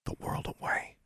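soft, voiceless whisper; hushed, confidential; whispered secret. A narrator's reading. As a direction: breathe it into a listener's ear. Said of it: "cupped a hand to his ear and said".

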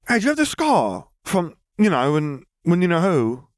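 put-on high, halting whisper-shy boy voice; excited but awkward boy; hesitant eager boy. A narrator's reading. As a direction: fumble toward a taboo name. Ron asks about scar.

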